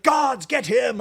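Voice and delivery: a hammed-up cartoon villain ordering his goons; mock shouting villain. Host mocks ranting villains, high mock cry. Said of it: "they're not like".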